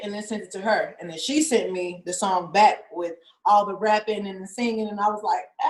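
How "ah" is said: high-pitched squeal of freaking out; imitated thrilled shriek; excited scream. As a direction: let out a high re-enacted scream. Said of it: "I was really freaking out".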